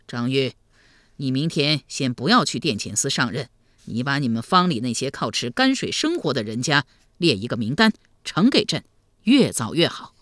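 low, controlled ruler's order; deep, measured sovereign elder; grave emperor. Acted. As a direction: instruct him soberly.

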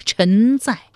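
hurried subordinate answering a summons; mildly panicked response; flustered reply. A narrator's reading. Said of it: "枢密副使慌忙应道".

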